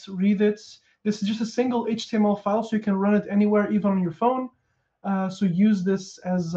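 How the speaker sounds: usual speaking voice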